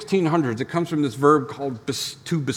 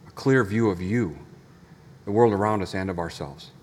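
low, soft, reverent prayer; hushed, measured pastoral prayer; 9 semitones lower, 6 dB quieter than usual. Real, not acted. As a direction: pray gently with long pauses between phrases. Leads the congregation in prayer.

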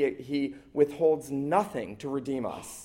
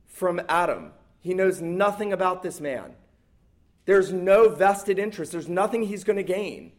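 raised, emphatic pulpit voice, driving each line home; preaching at full force; 6 semitones higher, 4 dB louder than usual. Acted. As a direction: preach it loud and high to the congregation. Preacher building the parable.

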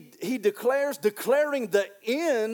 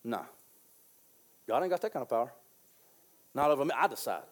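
defiant inner voice; 8 semitones lower, 7 dB quieter, faster than usual. Retold or imitated. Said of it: "In your heart, you just said".

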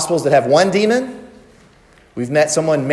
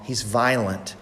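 usual speaking voice

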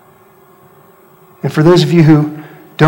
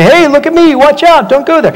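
high, frantic mock yell; berserk warning; 9 semitones higher, 3 dB louder than usual. Acting out someone warning a car, berserk high yell. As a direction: call out a warning, pitched way up. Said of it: "jump around and go berserk and say".

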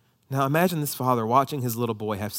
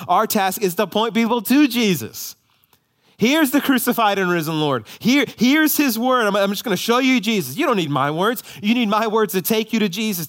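louder, rising, impassioned preaching; preaching at full force; 6 semitones higher, 6 dB louder than usual. Acted. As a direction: drive each line home to the congregation. Climax of sermon.

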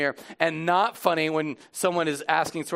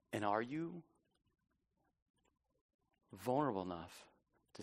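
soft, low, solemn appeal; reflective preaching; 6 semitones lower, 14 dB quieter than usual. Real, not acted. Pastor asking congregation about vulnerability, hushed and earnest. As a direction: pose it gently with long pauses. Reflective challenge closing the sermon.

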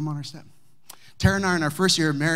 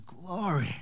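Howard's praise; 4 semitones higher, 5 dB quieter than usual. Retold or imitated. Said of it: "He'd always just go".

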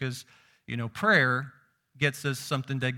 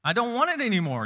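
higher, louder exasperated declaration; exasperated divine voice, higher and louder; God fed up; 7 semitones higher, 4 dB louder than usual. Retold or imitated. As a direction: proclaim it like a God who has had enough. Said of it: "And he's saying".

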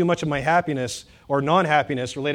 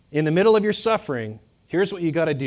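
ordinary delivery

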